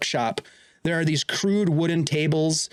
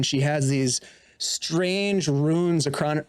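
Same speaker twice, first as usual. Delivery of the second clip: ordinary talk, nothing marked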